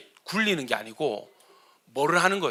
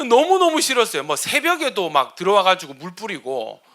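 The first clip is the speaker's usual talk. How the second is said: ordinary talk, nothing marked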